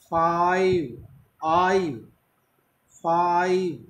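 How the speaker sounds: British "फाइव", with a long stressed आ; British English "फाइव", the आ first. Shown as a demonstration. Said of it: "आ पर stress रहेगा". (British अंदाज़ में "फाइव")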